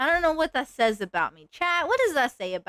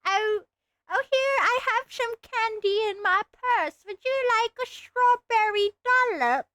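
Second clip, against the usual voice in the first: put-on doting old lady; 11 semitones higher than usual. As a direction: coo like a grandma digging in her purse. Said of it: "like an old lady pinching their cheeks".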